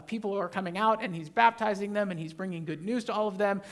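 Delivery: normal talking voice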